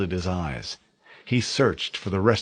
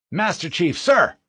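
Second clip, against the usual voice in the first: high, loud barked greeting; sharp salute; 10 semitones higher, 6 dB louder than usual. Acted. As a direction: snap to attention and call it out. Snapping to attention.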